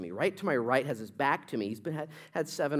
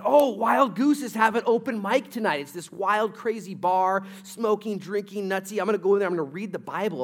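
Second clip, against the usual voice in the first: excited friend; 7 semitones higher, 7 dB louder than usual. Retold or imitated. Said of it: "and he said".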